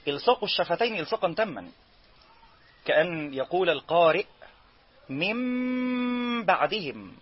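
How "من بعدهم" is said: من بعدهم with lips pressed into a heavy doubled m, high and slow; wrong doubled m. Shown as a demonstration. Teacher showing the forbidden full lip closure. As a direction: press the lips shut into mimb. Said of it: "هذا حولها إلى ميم مشددة".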